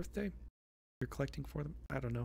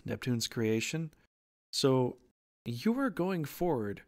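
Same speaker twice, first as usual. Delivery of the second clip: normal talking voice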